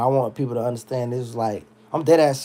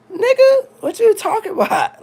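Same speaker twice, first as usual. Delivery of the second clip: incredulous outburst; 6 dB louder than usual. Real, not acted.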